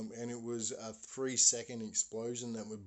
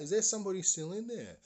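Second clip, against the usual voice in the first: high, fast shouted question into the burning house; retold yell; 9 semitones higher, 6 dB louder, faster than usual. A neighbour yells toward the burning house, high and fast. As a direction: call out loud and quick, urgent. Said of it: "someone yells out".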